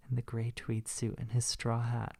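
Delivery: normal talking voice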